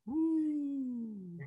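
high, soft ghostly wooo; drawn-out ghost moan, high and hushed; spooky ghost. Pretend. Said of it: "that's my impression of a spooky ghost".

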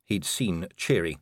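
ordinary delivery, nothing marked

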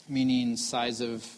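ordinary talk, nothing marked

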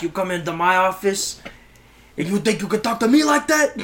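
affronted gangster building in pitch; Scarface. Acted. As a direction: confront them like an insulted drug lord. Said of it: "he's just sitting there like Scarface".

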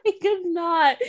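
high, tearful with laughter; laughing, near tears. Real, not acted. Telling her friend about the audiobook, crying with laughter.

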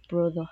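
"brother" ending in an open uh, no R; British ER as uh. Shown as a demonstration. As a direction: say "brother" British style, dropping the final R. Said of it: "change the E R sound become a".

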